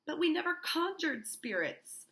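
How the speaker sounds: high-pitched, emphatic denial; indignant protest. Acted. Niece denying she conjured spirits, high emphatic protest. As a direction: act out an accused niece protesting.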